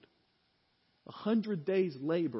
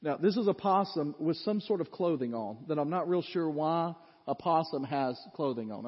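normal talking voice

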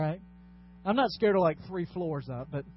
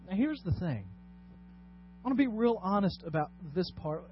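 ordinary talk

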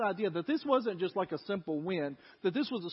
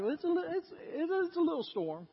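mock understatement; 9 semitones higher than usual. Dad downplays a hailstorm.

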